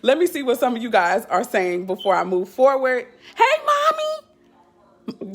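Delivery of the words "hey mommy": very high, slow sing-song hello; high drawn-out "Hey, Mommy" to her mother on the live; sing-song greeting. Real, not acted. Greeting mom joining the live.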